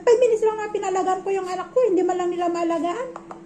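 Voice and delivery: high, loud complaining rant, quickening at the end; retold angry rant. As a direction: vent the grievance with rising heat. [name] ranting about the in-laws. (galit na reklamo, mataas at malakas)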